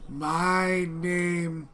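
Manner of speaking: slow, halting broken speech; slow halting Common from a dragonborn boy; super slow broken Common. Acted. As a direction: spell it out slowly for a foreigner. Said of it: "starts speaking in super slow Common".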